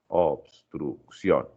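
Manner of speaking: "obstrucción" very slowly, obs-truc-ción, pausing between syllables; split by syllables. Shown as a demonstration. Teacher showing the class syllable division, "obstrucción" slowly split by syllables. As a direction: break "obstrucción" into three slow, separate syllables. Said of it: "dividir por sílabas".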